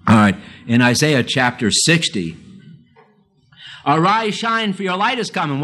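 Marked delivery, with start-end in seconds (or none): 3.9-5.5 high, ringing preacher's reading; scripture reading. Acted. Preacher reading Isaiah to his congregation, high and ringing. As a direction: proclaim the verse to the congregation.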